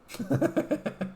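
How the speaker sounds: high burst of real laughter; a sudden caught-out laugh. Real, not acted. Caught out by his own retort.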